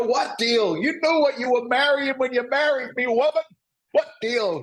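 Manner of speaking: high, loud mock outrage; acted indignant husband. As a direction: yell back in a comic marital row. Acted husband arguing back.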